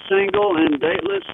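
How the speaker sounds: normal talking voice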